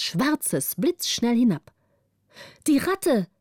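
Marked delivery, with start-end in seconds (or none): 2.7-3.3 higher, bright cry of delight; joyful cry. A narrator's reading. Gritta cries out in joy as the rat jumps, excited. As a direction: call it out with sudden joy. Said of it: "schrie Gritta voll Freude".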